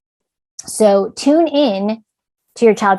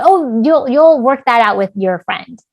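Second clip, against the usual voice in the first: dismissive reassurance; 3 semitones higher, 4 dB louder than usual. Acted. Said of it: "don't dismiss it".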